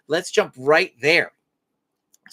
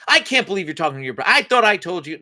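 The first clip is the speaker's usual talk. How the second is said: rapid-fire, exasperated telling-off; mock parental scolding; 2 semitones higher, 5 dB louder, faster than usual. Parent charging in at eight-year-old, mock scolding. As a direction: tear into the kid in a rush, hammed-up.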